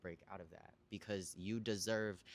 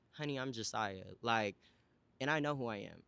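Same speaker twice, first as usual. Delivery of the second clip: usual speaking voice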